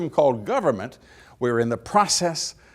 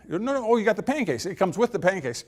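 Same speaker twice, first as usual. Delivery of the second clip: higher-pitched, lightly acted insistence; eager reassurance; 7 semitones higher, 4 dB quieter than usual. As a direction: jump in to correct the diner. Waitress says it comes free.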